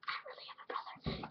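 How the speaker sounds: soft whispered aside; whispered aside to the camera. Real, not acted. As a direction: hush it as a secret.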